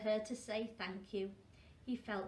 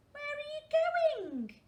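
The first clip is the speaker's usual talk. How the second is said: very high, loud, slow call; alarmed call; 9 dB louder, slower than usual. Acted. Girl calling after the diving penguin, high alarmed call. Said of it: "asked Tofter".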